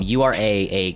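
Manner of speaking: normal talking voice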